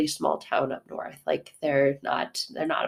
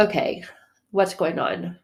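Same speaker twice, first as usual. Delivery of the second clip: ordinary delivery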